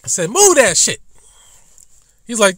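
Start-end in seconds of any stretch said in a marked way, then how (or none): 0.3-1.0 loud, high barked command at the driver; retold angry order. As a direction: yell it at the car ahead. Retold yelling at blocking driver.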